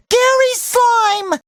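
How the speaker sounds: high, drawn-out cry of discovery; alarmed exclamation. Acted.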